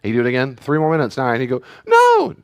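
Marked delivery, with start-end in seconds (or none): 1.8-2.3 shrill high shouted no; kid's defiant no. Retold or imitated. Son refusing his parents, shrill no. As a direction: yell it like a defiant kid.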